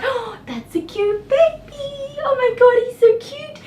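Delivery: put-on overwhelmed squeal at a cute baby; gushing over a baby. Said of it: "I'm like".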